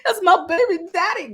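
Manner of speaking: high-pitched, gushing mock excitement; squealing, hammed-up bragging; excited. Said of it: "she's so excited. She's like".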